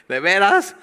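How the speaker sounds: high, loud incredulous squeak; mock disbelief. Burdened believer reacting to fifty kilos, loud disbelief. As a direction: shoot it up in disbelief.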